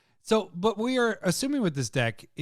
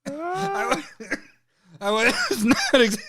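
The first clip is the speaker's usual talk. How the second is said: high cracking laugh through words; 9 semitones higher than usual. Real, not acted. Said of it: "I sound like an old man laughing".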